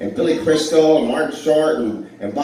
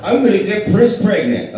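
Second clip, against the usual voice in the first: higher and louder, hitting the joke; punchline, louder; 7 semitones higher, 5 dB louder than usual. Shown as a demonstration. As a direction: deliver the line big for the recording. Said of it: "Okay, we got that one".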